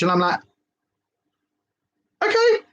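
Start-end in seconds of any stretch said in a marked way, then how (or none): 2.2-2.6 high, drawn-out sceptical okay; a long doubtful okay; unconvinced okay. Retold or imitated. Reacting to husband's answer.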